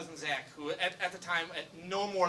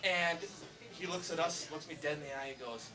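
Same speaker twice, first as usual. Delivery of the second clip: usual speaking voice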